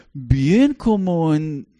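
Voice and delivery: ordinary delivery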